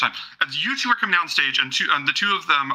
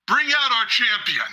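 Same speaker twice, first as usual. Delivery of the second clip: high, slow arena proclamation; drawn-out ceremonial call; announcer's call; 9 semitones higher than usual. Acted. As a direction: summon the champion for the whole crowd. King calls out the champion.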